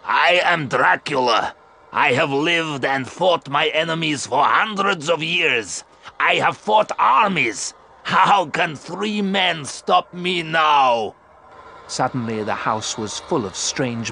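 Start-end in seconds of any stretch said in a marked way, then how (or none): 0.0-11.1 loud, slow, menacing vampire count with a final shout; a proud vampire daring his enemies, building to a yell; Dracula boasting. Acted. Dracula taunting the three men, loud and slow.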